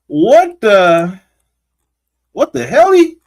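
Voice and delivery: loud, high-pitched bewilderment; playful high-pitched disbelief; baffled exclamation. Real, not acted. Baffled while searching the screen.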